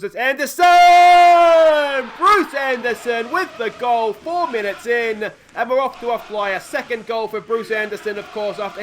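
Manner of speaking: loud, high excited goal shout; goal celebration. Real, not acted. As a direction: yell it like the ball just hit the net. Goal scored in his match.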